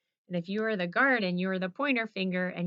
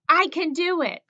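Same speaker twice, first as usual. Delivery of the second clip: cheer; 8 semitones higher, 8 dB louder than usual. Real, not acted. Group shout of affirmation.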